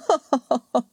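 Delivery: short, cold laugh falling away; a gloating laugh; villainous laugh. Acted. Prince gloating over his wife, short cold laugh.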